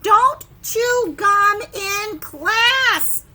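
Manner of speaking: teacher scolding a gum chewer, loud and slow; stern order. Acted. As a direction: spell out the rule slowly and loudly. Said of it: "There's another one".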